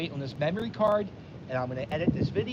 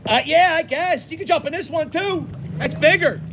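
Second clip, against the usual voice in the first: calling out; 10 semitones higher, 5 dB louder than usual. Real, not acted. Talking to someone off camera.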